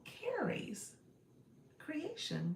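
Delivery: Carrie's said like the word carry, pitched high; Carrie's Creation with Carrie's said exactly like carry; corrected name. Real, not acted. Corrects a viewer's shop name after being told how. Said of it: "it's pronounced like the word carry".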